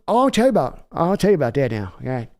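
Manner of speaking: a swaggering team owner selling a deal over the phone; hammed-up cocky billionaire owner working the phone; Jerry Jones impression. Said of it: "Kelly, may I ask what Jer-, how Jerry feels?".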